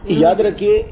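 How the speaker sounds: ordinary talk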